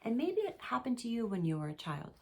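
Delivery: ordinary talk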